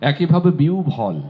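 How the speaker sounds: normal talking voice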